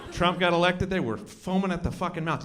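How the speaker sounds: ordinary delivery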